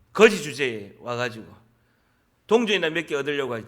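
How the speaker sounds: acted crowd putting him down; contemptuous scolding. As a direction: scold a beggar with disdain. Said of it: "꾸짖은 거예요".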